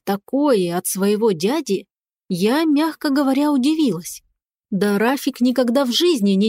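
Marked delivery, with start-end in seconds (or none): none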